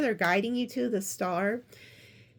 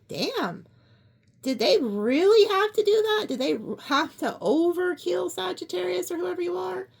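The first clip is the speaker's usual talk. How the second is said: imagined God reacting; 6 semitones higher than usual. God looks down at the crime scene, incredulous. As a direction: play God appalled at the crime. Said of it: "this angel or God walks up and is like".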